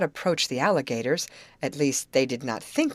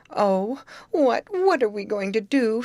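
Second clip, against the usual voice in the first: high, loud tearful plea; a sob breaking into the question; sobbing; 6 semitones higher, 4 dB louder than usual. A narrator's reading. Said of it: "sobbed Grace".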